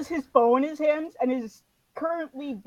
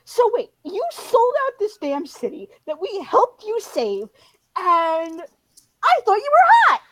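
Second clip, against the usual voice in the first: higher and louder, rising to an outraged yell; indignant outburst; 6 semitones higher, 6 dB louder than usual. Acted. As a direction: build from protest to a scandalised shout. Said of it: "Kick interrupts and is like".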